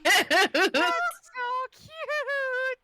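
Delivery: very high, loud, drawn-out gush. Real, not acted. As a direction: stretch out the cute. Gushing over trophy.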